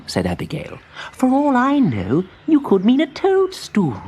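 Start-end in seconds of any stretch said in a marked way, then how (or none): 1.1-4.0 high, slow absent voice; distracted spider. A narrator's reading. Abigail answers from her spellbook, distracted. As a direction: answer without looking up.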